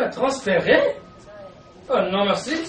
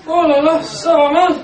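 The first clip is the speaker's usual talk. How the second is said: higher, louder worried outburst; 5 semitones higher, 5 dB louder than usual. Acted. Wife panicking over her changed husband, louder and higher. Husband thinks he's a lord.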